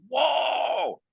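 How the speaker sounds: very high, drawn-out astonished whoa; amazed whoa. Retold or imitated. Someone learning the spirit's prediction came true, a very high amazed whoa. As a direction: gasp it out in wonder. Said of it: "And it's like".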